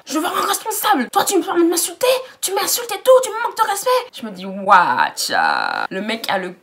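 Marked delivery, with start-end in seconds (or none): 0.0-3.9 high, mocking rant of the customer; mocking the angry man. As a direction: ham up his outraged complaint. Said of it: "il m'a tiré".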